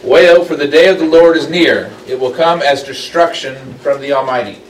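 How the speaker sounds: loud, high proclaiming of the verse; dramatic scripture reading. Acted. Teacher reading Isaiah's warning to the class, loud and proclaiming.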